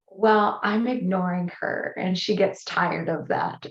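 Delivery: usual speaking voice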